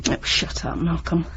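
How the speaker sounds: whispered, sharp shut-up; hushed snap. Acted. Sister hushing her brother in a sharp whisper. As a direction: hiss it under your breath so no one hears. Hushed rebuke between siblings.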